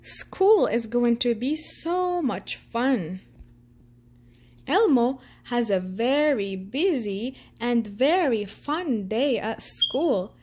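ordinary delivery, nothing marked

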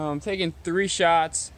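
ordinary talk

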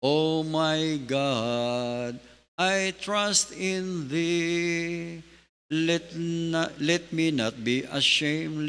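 slow, reverent reading of a psalm prayer; solemn prayer reading. Acted. Preacher opening the service prays slowly to God, reverent. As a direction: draw each word out as you pray to God.

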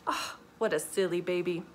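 ordinary delivery

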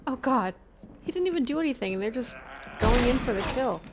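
ordinary talk, nothing marked

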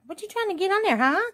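high pet voice; sing-song question to the dog. Real, not acted. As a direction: coo at the dog. Baby-talk to dog.

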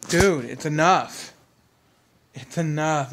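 slow, fed-up "enough"; exasperated. Real, not acted. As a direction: drag it out, sick of it. Exasperated rant.

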